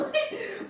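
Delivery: airy high laugh; breathy laugh. Real, not acted. Laughing at grandma story.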